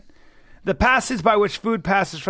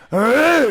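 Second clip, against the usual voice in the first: tennis grunt; 8 semitones higher, 7 dB louder than usual. Shown as a demonstration. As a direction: show the real grunt he made. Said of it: "I went".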